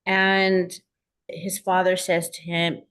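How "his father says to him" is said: normal talking voice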